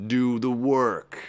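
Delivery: slow, drawn-out command; the stern teacher voice of Saturn; Saturn's commanding voice. Retold or imitated. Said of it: "this bigger force, Saturn".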